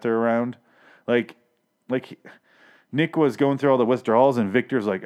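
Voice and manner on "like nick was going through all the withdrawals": usual speaking voice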